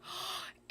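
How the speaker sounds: sharp, breathy gasp of surprise; sudden astonished intake of breath; shocked gasp. Real, not acted. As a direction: gasp at the big reveal. Reveal of flipped canvas.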